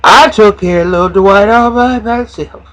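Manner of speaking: put-on high, loud, proud old mother; Lucius' mama. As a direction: offer to raise the baby, proudly.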